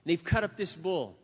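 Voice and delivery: normal talking voice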